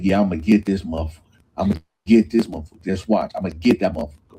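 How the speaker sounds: fast, low muttered vow; muttered threat. Retold or imitated. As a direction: rush it under your breath like a grudge. P Nice vowing revenge, low.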